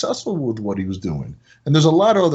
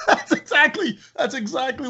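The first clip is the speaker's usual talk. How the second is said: laughing. Real, not acted. Laughing while telling.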